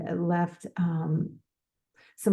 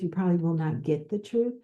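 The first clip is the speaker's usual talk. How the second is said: normal talking voice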